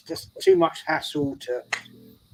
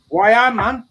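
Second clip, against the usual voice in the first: higher, louder attempt at Geordie; bad Geordie accent; 8 semitones higher, 6 dB louder than usual. Newcastle guy answering the visitor in a pub story, attempted Geordie accent. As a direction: have a rough go at a Geordie accent. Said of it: "I can't do a Geordie accent".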